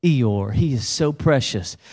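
mock fawning over something cute; imitated cooing. Preacher mimicking the congregation gushing over Eeyore, slow cooing. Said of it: "he is exactly what you just said".

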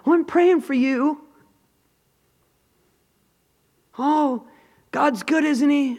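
high, hollow churchy cheer; parodying a hollow pastor's greeting; mock pious. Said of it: "tell people".

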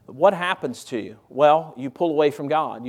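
ordinary delivery

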